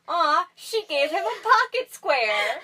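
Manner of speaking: high, slow, loud mock swoon; drawn-out, high-pitched gushing; gushing friend imitation. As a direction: imitate a friend cooing over something adorable. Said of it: "Sarah's actual words were".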